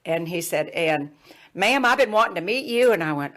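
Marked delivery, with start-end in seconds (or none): 0.7-2.9 higher, louder Southern gentleman; Jim Hickson, Southern accent. Retold or imitated. Medical student greets her at last, Southern accent. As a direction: imitate an eager, polite Southern man. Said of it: "he had this Southern accent".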